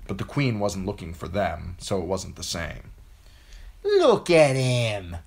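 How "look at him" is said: higher, louder, slow mocking bray; brayed taunt. A narrator's reading. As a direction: drag it out to mock. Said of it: "brayed Lommie Greenhands".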